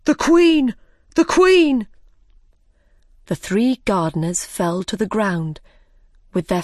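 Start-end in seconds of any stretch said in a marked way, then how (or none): 0.0-1.9 high, loud warning cry, falling at the end; sudden shout. A narrator's reading. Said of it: "Five shouted suddenly".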